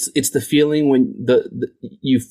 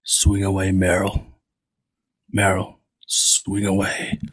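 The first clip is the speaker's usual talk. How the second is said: acting the quote; 6 semitones lower, 4 dB quieter, much slower than usual. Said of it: "Mel Gibson's got a little bit of a rasp".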